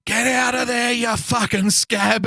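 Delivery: high, loud retold yell, slow on the insult; yelled out the window. Driver yelling out the window at the bin scavenger, high loud yell. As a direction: bellow it out the truck window at her. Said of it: "I yell out the window".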